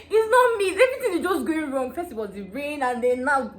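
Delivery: high, loud crying protest; tearful wail. Retold or imitated. Bride crying on wedding morning, loud tearful wail. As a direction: wail it through tears. Crying over spilled soup.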